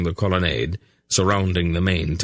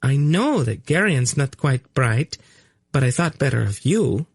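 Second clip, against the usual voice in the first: purring tease; 7 semitones higher than usual. A narrator's reading. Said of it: "she purred at him".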